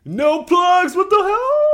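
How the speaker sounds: high shouted mock outrage. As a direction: yell it in fake shock. Comic outburst about plugs.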